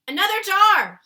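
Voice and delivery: excited giant's loud call; cry. A narrator's reading. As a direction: call out triumphantly. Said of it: "he cried".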